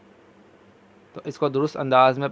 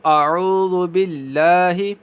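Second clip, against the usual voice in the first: recitation; 3 semitones higher than usual. Shown as a demonstration. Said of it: "تَعَوُّذْ۔".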